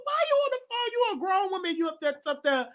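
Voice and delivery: high-pitched mock scolding; indignant telling-off. Telling off a grown woman on the phone, indignant.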